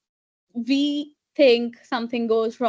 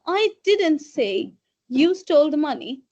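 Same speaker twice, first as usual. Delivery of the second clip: "didn't" punched higher, as a firm denial; stress on DIDN'T; 3 semitones higher than usual. Shown as a demonstration. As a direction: hit the word "didn't" to deny the fact. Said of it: "when I put emphasis on didn't".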